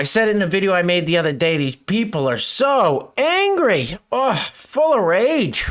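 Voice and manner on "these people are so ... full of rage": drawn-out, exaggerated exasperation; mock dismay. Real, not acted. Commentator reacting to an angry woman's video, mock dismay. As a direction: stretch it out, pitched up, mocking.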